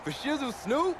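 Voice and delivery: fanboy trying out [name]'s slang, thrilled; giddy [name] slang. Acted. Playfully using [name]'s slang.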